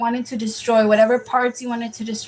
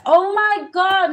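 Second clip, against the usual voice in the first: shocked realization; 8 semitones higher, slower than usual. Real, not acted. Realizes wrong person's reading.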